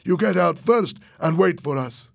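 acted commanding call; shouted order. A narrator's reading. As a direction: order him out firmly. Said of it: "Fernando shouted to Tony".

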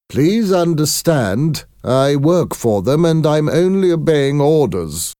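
high, insistent messenger voice; apologetic company visitor. A narrator's reading. Company man excusing himself to grieving parents, high and insistent.

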